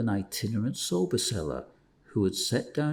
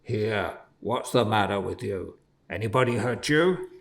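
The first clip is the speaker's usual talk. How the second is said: gruff peddler. A narrator's reading. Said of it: "roughly exclaimed the sober man".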